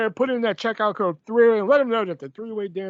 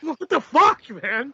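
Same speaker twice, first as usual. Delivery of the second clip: a louder, higher retold outburst of disbelief; mock exasperation; 7 semitones higher, 4 dB louder, slower than usual. Host retelling how he reacted to the clue, mock exasperated. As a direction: play back your own baffled outburst.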